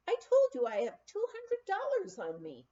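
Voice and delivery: high, anxious insisting, pitch jumping up; nervous guest frightened of sharing a dorm; frightened insistence. A narrator's reading. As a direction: plead it nervously, afraid for your money. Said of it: "It was complete fright".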